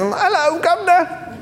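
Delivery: high, hammed-up English accent; jokey, put-on English "'ello guv'nor". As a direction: greet in a squeaky mock-British accent. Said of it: "one of those English accents".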